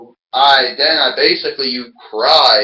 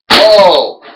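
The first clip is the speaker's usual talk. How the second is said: excited shout; 4 semitones higher, 6 dB louder than usual. Acted. Commentator reacting to big move.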